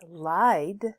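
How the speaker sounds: "lied" drawn out, ending on a voiced D; final D sound. Shown as a demonstration. Teacher shows students that "lied" ends in a D sound. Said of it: "it ends in a D".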